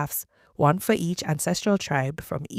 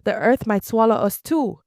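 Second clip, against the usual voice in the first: raised, higher narrated shout; alarmed shout; 6 semitones higher, 5 dB louder than usual. A narrator's reading. Israelites fleeing the shrieks as the earth opens, shouting. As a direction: read it as a crowd crying out, louder and higher.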